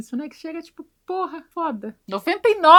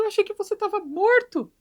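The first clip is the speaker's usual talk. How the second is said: surprised stepdad; 10 semitones higher than usual. Retold or imitated. Stepfather surprised to see Ed.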